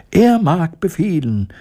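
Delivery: hoch gerufen wie ein Mäusechor. A narrator's reading. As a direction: hell und einstimmig rufen. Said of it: "riefen die Mäuse im Chor". (hoher Mäusechor)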